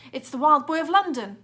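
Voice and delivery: officer spotting the fugitive; policeman yelling. A narrator's reading. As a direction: bellow it to the crowd. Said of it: "one of the officers yelled".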